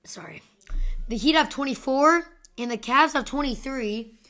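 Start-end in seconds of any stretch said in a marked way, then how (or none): 0.0-0.4 whisper. Real, not acted. Apologizing for the crack.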